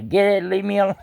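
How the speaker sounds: hammed-up yell into the dark; mock yell. Yelling at animals at night.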